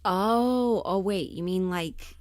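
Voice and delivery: ordinary delivery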